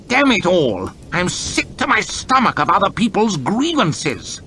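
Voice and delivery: irritable outburst. Acted. Lord chamberlain fed up with grievances, irritable. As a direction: snap with disgust. Hoff loses his temper.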